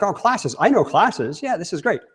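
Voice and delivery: lightly acted, high-pitched enthusiasm; breezy confidence. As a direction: imitate someone breezily sure they know this. Acting an attendee confident about classes.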